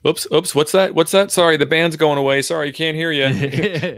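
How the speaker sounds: high, hammy fake bad signal; mock radio excuse. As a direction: pretend the band is fading away. Mock operator dodging a caller.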